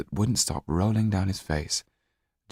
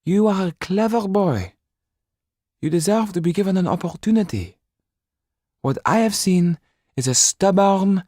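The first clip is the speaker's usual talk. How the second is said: much higher, louder stern woman; stern Mrs Laxton; 12 semitones higher, 6 dB louder than usual. Acted. Mrs Laxton scolds Jan for selfishness, high and stern. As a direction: scold the stubborn boy.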